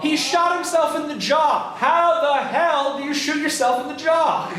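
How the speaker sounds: high-pitched, slow exclamation of disbelief; incredulous. Real, not acted. Teacher telling the class of the botched suicide, incredulous.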